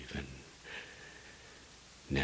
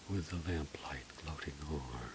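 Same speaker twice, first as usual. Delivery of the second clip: softer, a hushed fast murmur; whisper; 6 dB quieter, faster than usual. Acted. Narrator whispering the lamplight line to himself. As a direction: let it slip out under the breath. Repeated line dropped to hush.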